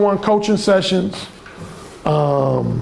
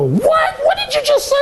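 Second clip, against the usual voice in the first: high, laughing shocked outburst; high laughing mock shock; shocked woman. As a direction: squeal in disbelief. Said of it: "a woman would go".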